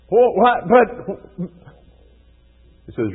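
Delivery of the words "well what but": much higher and louder, shocked protest; boy stunned he is getting a spanking; startled kid. Retold or imitated. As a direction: jolt like a kid who did not see it coming.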